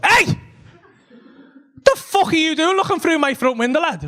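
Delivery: high-pitched confrontational yell; angry challenge. Retold or imitated. Man confronts a fella at his front window, angry challenge. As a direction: shout at someone caught snooping.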